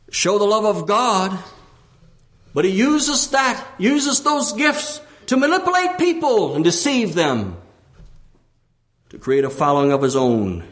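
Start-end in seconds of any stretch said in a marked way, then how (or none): 0.1-7.6 high, mounting sermon delivery; impassioned preaching. Real, not acted. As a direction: build to a high pulpit cry. Preaching at full force.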